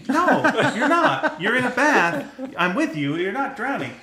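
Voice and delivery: laughing parent. Retold or imitated. Parent reassuring child.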